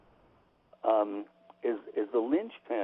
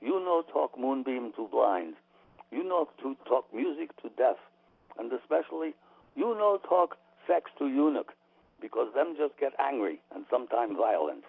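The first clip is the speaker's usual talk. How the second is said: imitating a Japanese teacher's accent; broken English in a Japanese accent. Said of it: "I'll do his Japanese accent as best I can".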